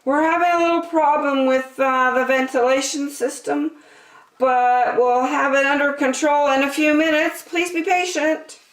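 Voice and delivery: high, fake-calm announcer voice; smooth over the problem brightly; cruise director. A narrator's reading.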